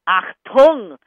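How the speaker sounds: loud, low German "Achtung"; punchy German-accented command. Shown as a demonstration. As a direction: bark it out as a German order. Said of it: "somebody talking Dutch or German".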